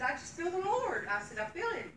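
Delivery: high, earnest cry of feeling the Lord; fervent. Retold or imitated. Telling the church why she cried, high fervent protest. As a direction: lift it up as if overcome by the Spirit.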